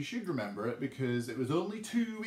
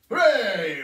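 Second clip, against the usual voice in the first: loud, high cheer of triumph; cheering hooray; 4 semitones higher, 11 dB louder than usual. Real, not acted. Teacher cheering the class after the twister. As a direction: celebrate like you just won.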